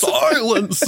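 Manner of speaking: high, drawn-out theatrical bellow; mock lion yell. Host replaying lion's correction, mock yelling. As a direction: roar the word in jest.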